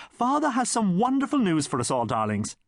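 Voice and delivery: high flustered actress voice; dramatic mother fluttering; theatrical mother. Acted. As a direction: flit about like a stage mother. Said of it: "gushed his mother".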